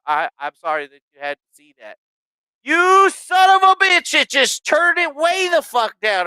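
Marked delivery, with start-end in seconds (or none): 2.6-6.3 slow, high, loud outraged yell; furious shout. Real, not acted. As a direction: explode at the program for turning the mic down. Mic volume dropped suddenly.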